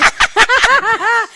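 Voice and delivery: loud burst of laughter; real amused laugh. Real, not acted. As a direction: crack up after teasing a friend. Laughs after teasing Naomi.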